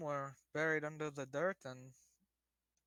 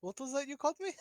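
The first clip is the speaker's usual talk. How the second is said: indignant surprise; 10 semitones higher, faster than usual. Acted. Player offended by "fine lady.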